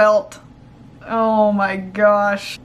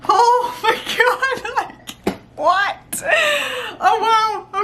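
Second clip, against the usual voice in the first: high giggling disbelief; laughing squeal; 9 semitones higher, slower than usual. Real, not acted. Fan giggles in disbelief at the records, laughing. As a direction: laugh through the words in awe.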